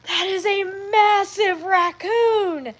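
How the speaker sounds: drawn-out awestruck; amazed. Retold or imitated. Husband amazed at footage.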